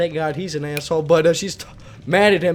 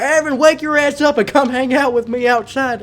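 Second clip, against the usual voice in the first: brother yelling; 7 semitones higher than usual. Retold or imitated. Drunk brother yelling him awake.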